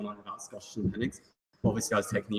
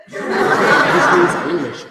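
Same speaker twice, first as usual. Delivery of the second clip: very slow, louder, laughing through it; 4 semitones higher, 11 dB louder, much slower than usual. Real, not acted. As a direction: savour the punchline with laughter. Laughing at own punchline.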